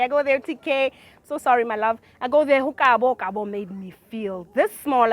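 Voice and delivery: ordinary talk, nothing marked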